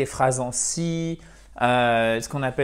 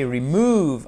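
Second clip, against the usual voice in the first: "remove" with the weight on "move"; "remove" stressed clearly on its second syllable. Shown as a demonstration. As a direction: lean into the end of "remove", re-MOVE. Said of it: "en mettant bien l'accent tonique".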